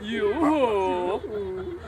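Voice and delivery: low, drawn-out flirty word; coquettish. Acted. Manservant flirting with his lady, low coquettish drawl. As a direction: let it slide out low and coy. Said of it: "as coquettishly as he can".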